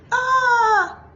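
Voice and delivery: high, hammed-up wail of despair; exaggerated mock wail. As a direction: throw out a fake cry. Mock cry in jealous quote.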